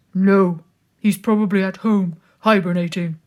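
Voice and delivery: loud, gruff bear growl. A narrator's reading. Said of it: "growled the bear".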